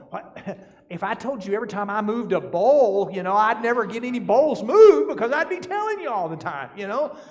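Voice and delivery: a hammed-up wife asking why she's being told; exasperated wife. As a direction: play the fed-up young wife, half laughing. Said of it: "she was like".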